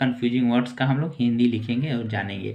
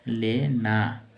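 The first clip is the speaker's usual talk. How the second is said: slow dictation; 2 semitones lower, much slower than usual. Shown as a demonstration. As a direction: stretch the word out very slowly. Teacher dictating the meaning. (बहुत धीरे, लिखवाते हुए)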